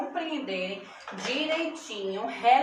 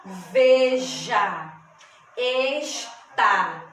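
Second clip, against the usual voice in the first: ve-ja, es-tá in loud, separate beats; split into syllables; 6 dB louder, much slower than usual. Shown as a demonstration. Teacher showing pupils how to separate syllables by pronouncing. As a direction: sound out veja and está syllable by syllable. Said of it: "só você pronunciar".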